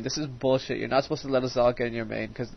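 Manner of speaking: normal talking voice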